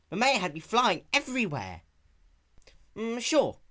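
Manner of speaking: high, loud cartoon voices; lively pony chatter; cartoon character voices. Dialogue voiced in story.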